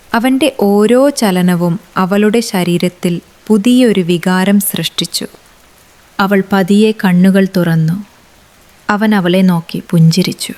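ordinary delivery

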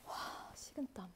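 breathless relieved whisper. Real, not acted. Idol whispering about cold sweat after a tense step.

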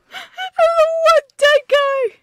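shrill, very slow high squeal; high squeaky cartoon voice. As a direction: squeak it out as a goofy cowboy. Mimicking the cowboy character.

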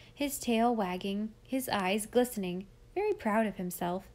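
ordinary delivery, nothing marked

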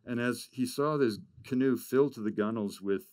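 usual speaking voice

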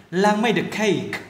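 slow demo with "made a" joined as may-duh; linked sounds. Shown as a demonstration. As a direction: link made into a, cake kept clear. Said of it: "đọc nối âm ờ này luôn". (Lan made a cake, đọc nối "made a" chậm rãi)

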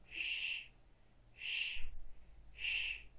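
sh as a sustained voiceless hiss, three times; digraph sh sound. Shown as a demonstration. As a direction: isolate the sh, pausing between each. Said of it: "Digraph S I".

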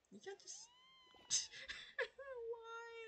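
screaming goat. Retold or imitated. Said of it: "You sound like a screaming goat".

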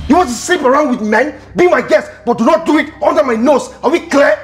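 angry scolding. Acted. Boss scolding house help about men, angry. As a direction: lay down the law angrily.

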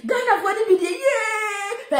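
high wailing mock sob; hammed-up crying outburst, voice cracking up; mocking a man crying to the media; mock crying shout. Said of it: "who he say".

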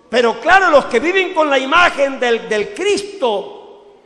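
fiery preaching. Acted. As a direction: preach it out to the whole church. Preaching at full force. (predicación encendida, fuerte y aguda)

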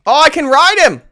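ordinary talk, nothing marked

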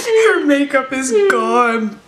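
high, breathless laughing fit; crying laughing. Real, not acted. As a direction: laugh till you cry.

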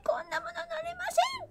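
high, fast breathless whisper of refusal; scared whisper. Acted. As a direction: whisper it like you're too scared to ride. (怯えた高いささやき声)

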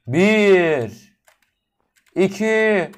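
tiz, gür sayım ve "bismillah" haykırışı; bağırarak geri sayım. Real, not acted. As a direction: kendini cesaretlendirerek bağır. Shouting countdown before jumping. (atlamadan önce gür, heyecanlı sayım)

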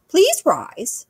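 regal queen. Acted. Queen receiving her visitor, in a high regal voice. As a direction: speak high, like a gracious monarch.

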